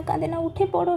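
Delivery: usual speaking voice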